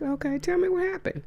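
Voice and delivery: high, sing-song mock politeness; mock sweet okay. To a gossiping coworker, sweet high sarcasm.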